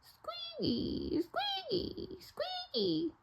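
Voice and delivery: very high, soft squeaking; squeaky windmill. Acted. Storyteller squeaking as the turning windmill. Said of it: "said the windmill".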